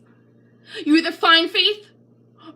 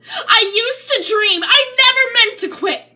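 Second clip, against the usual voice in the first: high, loud, voice cracking into sobs; a loud weeping climax; tearful crescendo; 9 semitones higher, 5 dB louder than usual. Acted. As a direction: break down as it builds.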